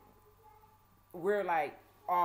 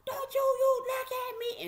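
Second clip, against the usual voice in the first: high, menacing holler; imitated screaming guard; 6 dB louder than usual. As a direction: scream orders like a mean sheriff. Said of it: "screaming and hollering at us".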